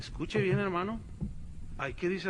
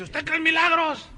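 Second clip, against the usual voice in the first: high, loud showman preacher asking the crowd; loud preacher question; 10 semitones higher, 6 dB louder than usual. Retold or imitated. Mocked megachurch preacher asks the crowd, loud and high. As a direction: belt it out like a TV evangelist.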